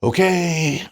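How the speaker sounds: high, drawn-out mock hesitation; hammed-up uncertain acceptance. Bettor reacts to his push-up target, mock-daunted. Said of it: "And I'm like".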